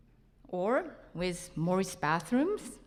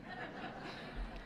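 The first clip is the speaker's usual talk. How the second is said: airy chuckle; 14 dB quieter than usual. Real, not acted. Laughs about hand cream.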